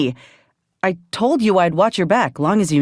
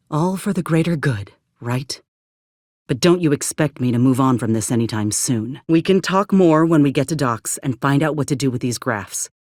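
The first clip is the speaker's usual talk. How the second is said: usual speaking voice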